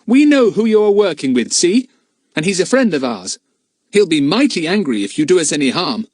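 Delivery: put-on boy explaining to the smugglers; boy's voice. A narrator's reading. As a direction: answer like an eager schoolboy. Philip warns Jake.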